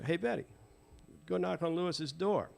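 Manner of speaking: lightly acted man sending her on an errand; imitated coaxing request.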